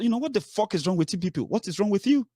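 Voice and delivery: exasperated outburst. Real, not acted. Speaker berating followers who doubt the lawyer, exasperated. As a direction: fire it off in disbelief.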